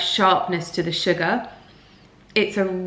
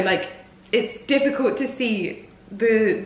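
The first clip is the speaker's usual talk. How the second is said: ordinary talk, nothing marked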